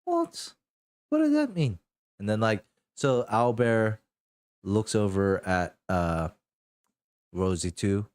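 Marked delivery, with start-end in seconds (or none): 0.0-1.8 very high alarmed question; suddenly worried, ask what it means; startled. Acted. Rosie alarmed by pet.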